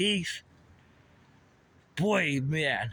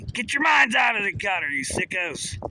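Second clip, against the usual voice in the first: mock scolding shout; 4 semitones higher than usual. Real, not acted. Vlogger scolding his viewers for dirty minds, shouting.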